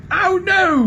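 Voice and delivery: very high, loud bellow. A narrator's reading. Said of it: "bellowed Trumpkin".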